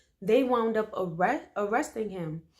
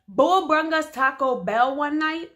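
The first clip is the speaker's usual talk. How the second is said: indignant; 6 semitones higher, 6 dB louder than usual. Real, not acted. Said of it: "nasty as hell".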